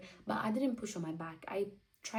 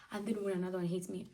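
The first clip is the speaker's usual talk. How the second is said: ordinary delivery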